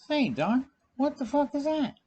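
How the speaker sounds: high-pitched incredulous question to a doctor; mock alarm. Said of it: "I'm like".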